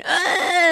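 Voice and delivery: high mock scream, drawn out; imitated scream. Host retelling chili panic to friend, mock screaming. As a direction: re-enact a scream from chili burn. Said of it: "screaming, going".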